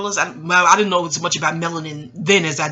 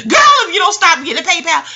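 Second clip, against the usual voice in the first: high, loud excited call-out; excited outburst; 10 semitones higher, 10 dB louder than usual. Real, not acted. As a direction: burst out hyped and loud.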